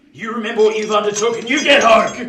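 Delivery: loud, high final order; loud command. Acted. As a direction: send him off forcefully. Convict sends boy home.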